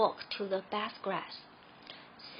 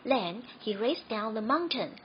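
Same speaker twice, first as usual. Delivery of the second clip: plain reading voice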